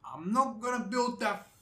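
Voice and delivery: put-on slow, high Spanish accent. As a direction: play the Mexican president in a thick Spanish accent. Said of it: "literally in a Spanish accent".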